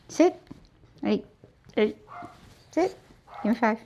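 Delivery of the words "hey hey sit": ordinary talk, nothing marked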